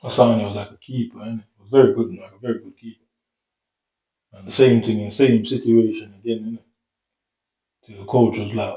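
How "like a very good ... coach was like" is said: ordinary talk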